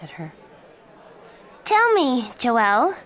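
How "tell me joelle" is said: loud and sharp, much higher; angry. A narrator's reading. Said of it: "Miranda said angrily".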